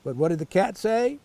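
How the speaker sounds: usual speaking voice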